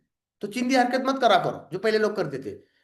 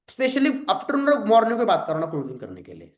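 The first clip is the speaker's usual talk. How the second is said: ordinary delivery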